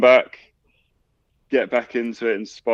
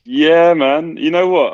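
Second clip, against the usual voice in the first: excited; 9 semitones higher, 8 dB louder, slower than usual. Real, not acted. Excited reply about summer holiday.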